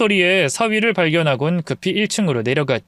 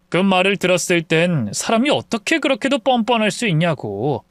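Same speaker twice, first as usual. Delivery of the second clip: usual speaking voice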